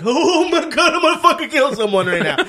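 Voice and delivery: high, loud, fast fury through laughter; mock rage, loud and high; furious laughing rant. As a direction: blow up at the noise, half laughing. Enraged by late-night noise.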